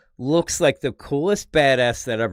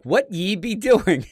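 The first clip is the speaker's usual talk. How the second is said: put-on higher, slow, old-timey Thor voice; mock Thor speak; 7 semitones higher, slower than usual. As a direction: ham up archaic godly speech.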